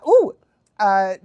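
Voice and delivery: ordinary delivery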